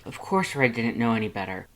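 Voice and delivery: usual speaking voice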